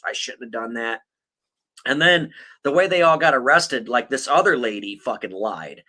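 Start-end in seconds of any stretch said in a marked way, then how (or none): 0.0-1.0 softer, lower mock-contrite voice; retold sheepish apology. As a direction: imitate a sheepish confession, trailing quieter. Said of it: "was like, "I apologize".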